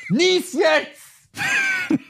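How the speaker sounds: high frantic yell; shouting. Retold or imitated. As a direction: yell it out impatiently. Impatient thought at sneezing mother.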